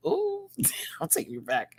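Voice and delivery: whispered with a laugh. Real, not acted. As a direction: breathe it out nostalgically. Whispered nostalgic repeat.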